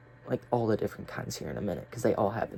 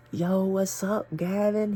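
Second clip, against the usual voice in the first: higher, loud call across the table; excited greeting; 8 semitones higher, 4 dB louder, slower than usual. Retold or imitated. Greeting a hallucinated friend across the table, excited call. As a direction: hail your buddy with a big grin.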